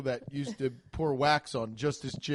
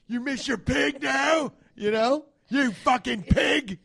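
loud, high, drawn-out jeering shouts; hammed-up bullying tirade; mock raging dad; 10 semitones higher, 7 dB louder, slower than usual. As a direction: berate the child like a furious chef. Acts Ramsay raging at his children.

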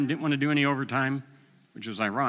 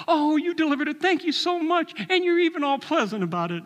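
much higher, bright delighted thanks; gushing customer; 3 dB louder than usual. Retold or imitated. Customer thanking the delivery man, high and gushing. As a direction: play the thrilled customer, way up high. Grateful customer voiced in story.

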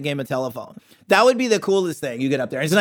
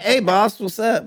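put-on higher-pitched, tentative Indian accent; half-hearted Indian accent; 7 semitones higher, slower than usual. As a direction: attempt an Indian-accented shop greeting. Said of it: "not gonna do the Indian accent".

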